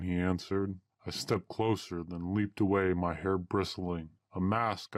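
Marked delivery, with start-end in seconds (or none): none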